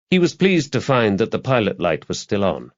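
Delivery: plain reading voice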